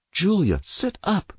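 high, sharp scolding. Acted. Mother scolds Julia at the table, high and sharp. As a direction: scold her posture sharply. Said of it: "Mother continued with a sharp voice".

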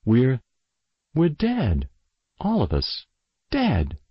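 tense revelation. A narrator's reading. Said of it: "Julia took a deep breath".

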